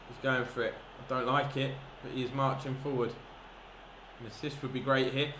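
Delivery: usual speaking voice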